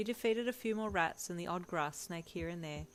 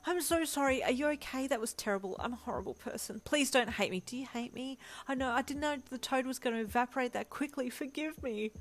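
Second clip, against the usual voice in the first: higher, rushed apologies; flustered, pleading, higher voice; frantic apologies; 7 semitones higher than usual. A narrator's reading. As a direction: pour out sorry after sorry. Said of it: "a barrage of apologies".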